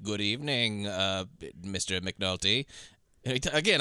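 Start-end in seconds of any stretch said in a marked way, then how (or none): 0.0-2.6 low, slow Lecter impression; Hannibal Lecter voice. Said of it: "I keep doing that".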